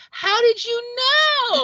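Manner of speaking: high, slow, drawn-out Capote impression; Truman Capote voice. Capote startled by Harper Lee's question, in a high slow Capote voice. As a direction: stretch the question way up and slow it right down. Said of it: "I can't help but do the voice".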